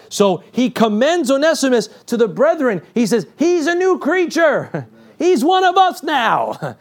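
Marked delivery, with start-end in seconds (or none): 3.4-6.8 slow, high playful voice of Paul; a hint of a beaming apostle; playful voicing. Paul commending Onesimus, playful voice.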